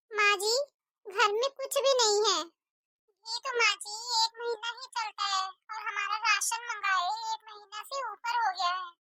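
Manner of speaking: ordinary delivery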